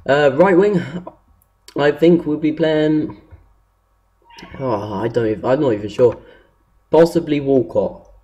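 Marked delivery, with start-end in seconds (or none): none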